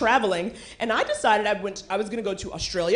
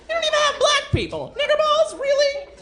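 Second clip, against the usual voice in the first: much higher, fast indignant outrage; indignant squeal; 3 dB louder than usual. Acted. Scolding Sweden, high-pitched indignant outrage. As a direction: squeak out your disbelief.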